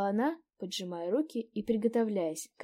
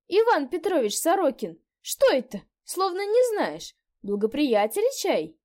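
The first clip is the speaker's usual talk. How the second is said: put-on old landlady; 9 semitones higher, 10 dB louder than usual. A narrator's reading. Landlady chatting.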